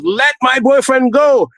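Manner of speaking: high, drawn-out mock yell; imitated girlfriend's demand. Girlfriend kicking him in a fight.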